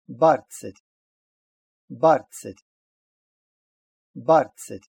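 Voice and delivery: "барцет" with voiceless "ц" after "р". Shown as a demonstration. Teacher giving an example for learners, "ц" in "барцет". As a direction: stress the first syllable of "барцет", crisp ts following. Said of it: "иногда произносится «ц»".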